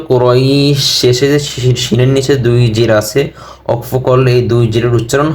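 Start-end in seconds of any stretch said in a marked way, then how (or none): 0.1-0.8 "কুরাইশ" ending on a bare sh, no vowel after it; pausal pronunciation. Shown as a demonstration. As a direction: stop "কুরাইশ" on the sh after a long glide. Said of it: "ওকফ করলে উচ্চারণ হবে".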